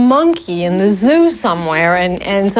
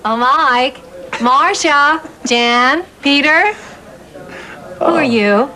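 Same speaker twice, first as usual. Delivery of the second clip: higher, sweet TV mom calling; Carol Brady voice; 7 semitones higher, slower than usual. Shown as a demonstration. Actress doing the Carol voice for the camera. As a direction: call the kids sweetly, a TV mother. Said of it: "the Carol voice".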